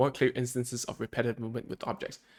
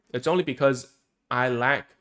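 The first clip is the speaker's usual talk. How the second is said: ordinary delivery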